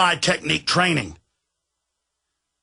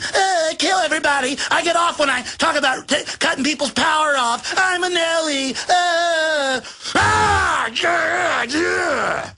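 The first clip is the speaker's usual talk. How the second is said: high, squeaky, simpering mock elite; chicken neck Nelly; 11 semitones higher than usual. Host mocking elites who get off on power cuts, squeaky simpering voice. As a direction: squeal it like a giddy little weasel. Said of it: "little chicken neck Nellies going".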